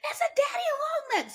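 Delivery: scared shout about the daddy long legs; panicked shout. Retold or imitated. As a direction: shriek it at the boyfriend. Calling out about spider.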